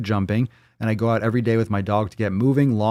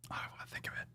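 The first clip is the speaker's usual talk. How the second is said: barely audible whisper; 24 dB quieter, much faster than usual. Real, not acted. Host to self about an unwelcome thought, whispered. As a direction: whisper it under your breath.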